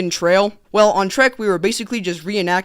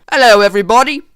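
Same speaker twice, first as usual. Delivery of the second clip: loud British greeting; hammed-up British hello; British accent; 2 semitones higher, 8 dB louder, faster than usual. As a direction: announce yourself as a British lad. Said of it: "pioneers had British accents in that scene".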